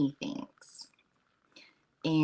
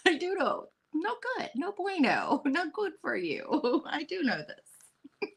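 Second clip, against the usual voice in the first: high, giggling confession; pitched-up giggly admission; laughing; 12 semitones higher, 4 dB quieter than usual. Real, not acted. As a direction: laugh through the guilty admission. Playful admission about Doritos.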